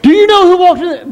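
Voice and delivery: high, breathless mock excitement; excited gossip. Preacher mimics a listener bursting with news, high and excited. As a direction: squeal it like big news. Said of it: "You'd probably say".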